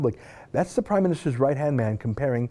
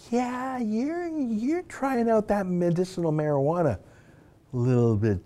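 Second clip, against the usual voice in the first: high, teasing sarcasm; mocking jab; 7 semitones higher than usual. Real, not acted. Host teasing the columnist about his wild tweet, mocking sarcasm. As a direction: needle him like he's a stoner.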